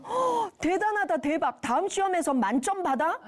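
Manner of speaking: hammed-up parent's amazement; overexcited praise. Parent praising a child's exam score, overexcited.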